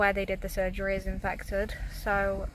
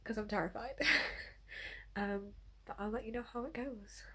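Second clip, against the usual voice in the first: hushed early morning whisper; 11 dB quieter than usual. Real, not acted. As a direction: keep it to a whisper.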